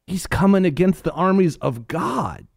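high, slow, outraged proclamation; indignant young David. Retold or imitated. David posting about the Philistine, slow high outrage. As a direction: proclaim it slowly like a scandalised youth.

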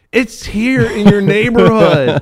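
high, loud, slow mock exasperation; exasperated retort. Storyteller arguing with a little girl about her dog, exasperated. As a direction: spell it out loudly, in disbelief.

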